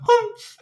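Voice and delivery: high, indignant huff; kangaroo humph. Retold or imitated. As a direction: snort it out high and offended. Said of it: "she's like".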